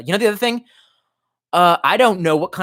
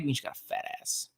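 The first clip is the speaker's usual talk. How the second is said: whispered; 16 dB quieter than usual. Retold or imitated. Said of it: "I'm just like".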